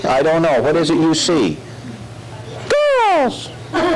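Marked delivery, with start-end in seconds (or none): 2.7-3.9 very high excited puppet. Acted. Dummy spots the girls, very high excited puppet voice. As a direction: squeal the word with delight.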